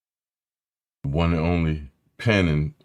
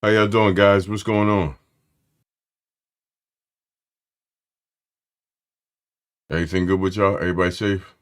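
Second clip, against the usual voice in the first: louder, higher hello to everyone; loud call-out; 3 semitones higher, 5 dB louder than usual. Real, not acted. Streamer calling out hello to the whole chat, loud.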